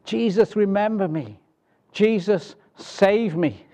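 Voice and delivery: high, pleading cry to Jesus; thief crying out. Acted. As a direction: beg Jesus from the cross. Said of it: "that's what this thief on the cross did".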